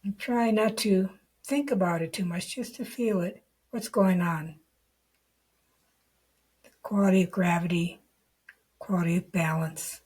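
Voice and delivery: guided meditation. Real, not acted. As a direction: lead the listener unhurriedly into the body, leaving long silences.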